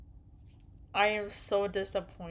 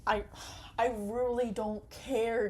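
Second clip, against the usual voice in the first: ordinary talk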